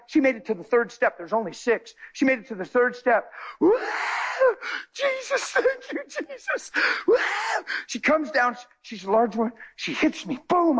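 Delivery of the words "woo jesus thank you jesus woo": high, thin, breathy praise cry; voicing her overjoyed shout up high; joyful praise. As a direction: cry out thanks to Jesus in delight. Woman's joy on the steps.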